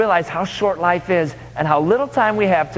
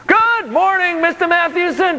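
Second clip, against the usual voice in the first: much higher, sunny greeting voice; a beaming doorman calling out hello; cheerful security guard; 12 semitones higher than usual. Acted. Security guard Joe greeting Mathewson in the lobby, much higher and chirpy. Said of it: "I hear Joe".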